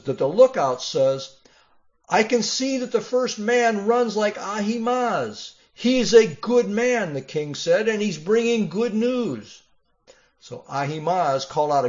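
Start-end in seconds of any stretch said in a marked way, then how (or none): 2.1-7.1 eager lookout. Acted. Lookout voiced in Bible story. 7.9-9.6 hopeful king. Acted.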